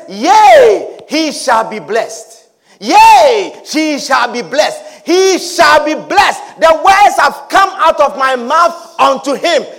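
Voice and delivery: loud, slow Yea, he shall be blessed; loud solemn proclamation; proclaiming shout. Acted. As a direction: declare it like a final decree.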